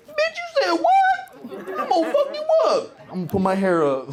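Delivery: very high, loud furious yell; angry woman in a fight. Acted. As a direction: scream it like a fight is starting.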